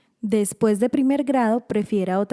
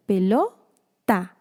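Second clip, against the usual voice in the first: "Pelota" slowly, with a gap: pelo, ta; added syllable set apart; much slower than usual. Shown as a demonstration. Teacher adding a syllable, "Pelota" split pelo, ta. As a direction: pause before the last syllable of "Pelota": pelo... ta. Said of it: "Agregue una sílaba".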